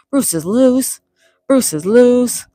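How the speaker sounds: high, loud hype call; excited call-out. Real, not acted. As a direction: announce it loud and playfully high. Calling out a chat member's catchphrase.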